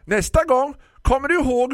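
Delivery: high, loud shout after someone leaving; calling after. Acted. Florist calls loudly after the departing customer. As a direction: call across the shop. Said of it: "ropade Tisten efter mig".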